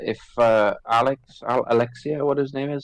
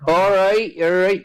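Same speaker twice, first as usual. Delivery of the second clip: mock West Country; 8 semitones higher, slower than usual. Teasing the Bristol accent.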